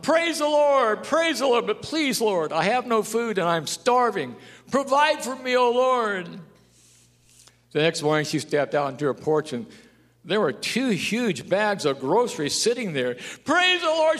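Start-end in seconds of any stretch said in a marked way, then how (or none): 0.0-6.3 put-on high, loud, slow old lady's plea; old lady crying out. 13.4-14.2 put-on high loud joyful old lady; old lady crying out. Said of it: "she cried out".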